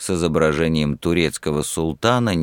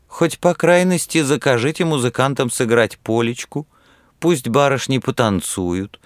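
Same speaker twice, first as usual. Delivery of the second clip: grumbling; 8 semitones higher than usual. A narrator's reading. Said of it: "попросила ворчливо Люба".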